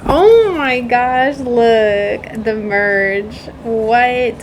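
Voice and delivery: normal talking voice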